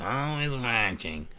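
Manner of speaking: slow, lightly creepy watcher voice; impression of Sarah. Streamer imitating Sarah watching the chat, slow and eerie. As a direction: drag it out like someone always watching. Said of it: "Sarah's actually Ross from Monsters, Inc".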